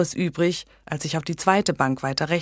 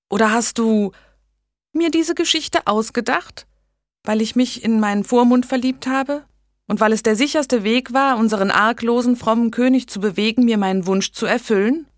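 put-on a young woman's voice, higher and louder, needling; teasing challenge; 7 semitones higher, 5 dB louder than usual. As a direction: catch her friend out, knowingly. Blanche challenges Megan.

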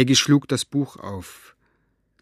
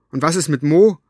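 demanding; 3 semitones higher, 7 dB louder than usual. Acted. Maggie demands angrily.

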